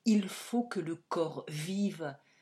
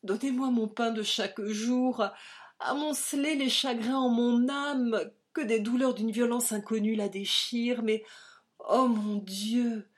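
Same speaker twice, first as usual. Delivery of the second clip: desperate prayer; 6 semitones higher, 6 dB louder than usual. A narrator's reading. Vanier praying for his life, breaking into sobs. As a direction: beg God until the voice breaks into crying. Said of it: "Vanier s'écria".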